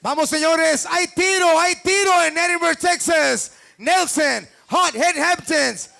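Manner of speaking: high, energetic fight announcer calls; hype announcer. Real, not acted. Hype host calling out fighters.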